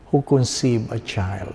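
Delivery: ordinary delivery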